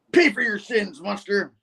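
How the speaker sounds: high-pitched mock battle cry; vengeful attacker. Cyborg attacking Norman for his past, high vengeful cry. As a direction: act out a high, accusing threat. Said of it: "then the guy's like".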